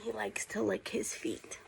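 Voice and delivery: quick whispered remark about the dog; hushed off-mic aside. Real, not acted. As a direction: drop to a whisper for a side comment.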